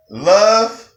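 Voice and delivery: Love, loud and high, held; emphatic Love; stress on love. Real, not acted. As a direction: punch the word Love. Emphatic first principle.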